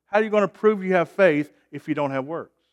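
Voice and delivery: usual speaking voice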